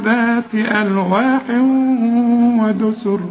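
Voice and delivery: slow chanted tajweed recitation; Quran recitation. Acted. Teacher leading the class in reciting the verses, slow chanted tajweed. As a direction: chant each verse slowly, holding the long vowels.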